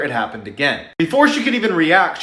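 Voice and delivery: ordinary delivery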